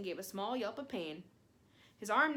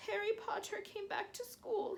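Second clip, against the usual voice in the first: whispered miserably; 12 semitones higher than usual. A narrator's reading. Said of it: "he whispered miserably".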